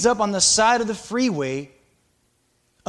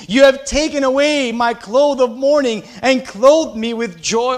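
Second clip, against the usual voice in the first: louder, proclaiming the psalm as a prayer; fervent scripture reading; 6 dB louder than usual. Acted. Preacher reads the psalm to the congregation, fervent. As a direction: call out the verse to the Lord. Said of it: "God has rescued you from the grave".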